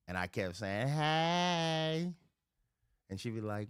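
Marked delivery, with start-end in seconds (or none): 0.9-2.1 drawn-out, higher flirty "hey"; flirty call. Retold or imitated. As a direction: stretch it out like a playful come-on.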